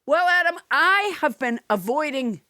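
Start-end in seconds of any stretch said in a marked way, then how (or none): none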